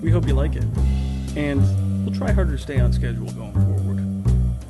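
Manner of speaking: usual speaking voice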